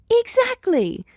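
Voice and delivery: very high delighted burst; a gleeful shout of agreement; exclaiming. Acted. Nedzu agrees with Big Eri, a delighted high exclamation. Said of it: "Nedzu exclaims".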